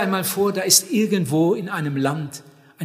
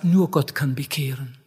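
a lower, mock objector's voice; voicing the objector; 6 semitones lower than usual. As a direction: play the objector with nur stressed. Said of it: "Jemand sagt".

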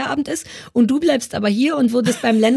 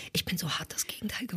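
whispered aside, very soft; whispering; 3 semitones lower, 15 dB quieter, faster than usual. Real, not acted. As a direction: whisper it as a secret confession.

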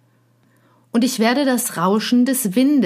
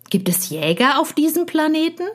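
higher-pitched eager question; excited; 9 semitones higher, faster than usual. A narrator's reading. Fox asks about hunters on that planet, excited. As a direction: ask it with excited, climbing curiosity. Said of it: "schien sehr aufgeregt".

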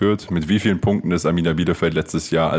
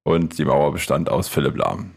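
normal talking voice

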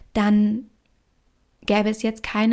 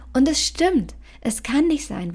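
ordinary talk

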